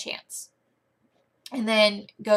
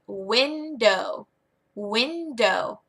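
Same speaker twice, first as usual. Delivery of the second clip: Window drawn out in two clear beats; slow, separated syllables on Window; two-syllable modeling; much slower than usual. Shown as a demonstration. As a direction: stretch Window as "winnn-dohh" for a learner. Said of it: "when it's two syllables".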